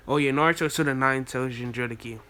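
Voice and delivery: usual speaking voice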